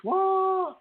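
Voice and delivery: a sudden high, loud, incredulous "What?"; baffled what. Pretend. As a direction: squawk it like a baffled reader. Mock reaction to his writing.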